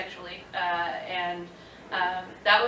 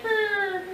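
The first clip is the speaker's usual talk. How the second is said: panicked shiver noise; 13 semitones higher, 4 dB louder than usual. Retold or imitated. Sudden fear after quitting.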